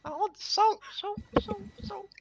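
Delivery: high, then fading to a whisper; eerie well voice. Acted. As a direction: crave it, trailing into a whisper. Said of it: "you hear that coming from the well".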